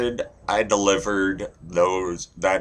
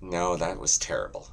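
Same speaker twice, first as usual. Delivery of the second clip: ordinary talk, nothing marked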